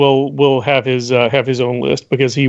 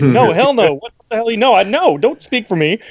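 imagined Chewbacca protest; 7 semitones higher than usual. Retold or imitated. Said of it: "as if to say".

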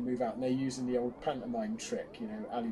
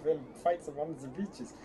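mock German general's accent; cod German accent. Co-host mimicking a general in the trenches, cod German accent. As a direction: imitate a bad stage German. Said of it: "cod German accent".

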